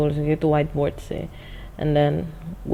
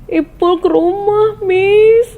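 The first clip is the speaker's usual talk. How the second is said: loud, high drawn-out wail; menangis; 7 dB louder, much slower than usual. Retold or imitated. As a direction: wail it slowly like a crying pupil. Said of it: "Terus dia nangis terus". (meratap keras menirukan murid menangis)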